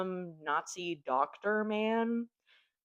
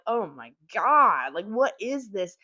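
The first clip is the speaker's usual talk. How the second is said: shocked delight; 3 semitones higher, 8 dB louder, slower than usual. Retold or imitated. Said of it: "I need something that's just like".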